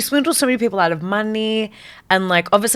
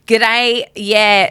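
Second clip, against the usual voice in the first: bad Aussie accent; 3 semitones higher, 5 dB louder than usual. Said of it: "and then they'd be like".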